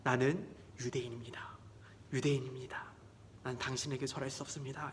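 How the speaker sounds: whispered, hushed refusal; quiet firm refusal. Acted. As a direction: say it softly but firmly. Mordecai refuses Haman.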